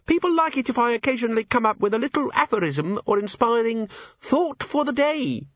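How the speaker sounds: very high, squeaky recital; pocket imp. Acted. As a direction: recite like a tiny creature in a pocket.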